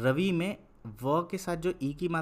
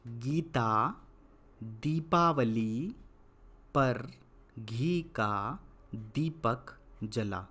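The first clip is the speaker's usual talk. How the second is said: slow dictation; much slower than usual. Shown as a demonstration. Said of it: "इसको भी लिख लेते हैं".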